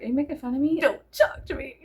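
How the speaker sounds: confrontational mock Boston voice; touchy Bostonian, imitated; Boston accent. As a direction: challenge them in a put-on Boston accent. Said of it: "too many Boston accents".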